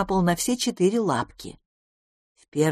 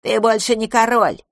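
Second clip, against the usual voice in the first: higher, sharp contradiction; curt interruption; 5 semitones higher, 4 dB louder than usual. A narrator's reading. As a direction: cut the king short. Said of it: "оборвала его одна из летучих мышей". (резко обрывая)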